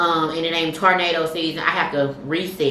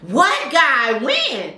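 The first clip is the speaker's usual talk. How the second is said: retold shock, pitched up and pushed; demanding disbelief; 7 semitones higher, much slower than usual. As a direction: grill her like a nosy best friend.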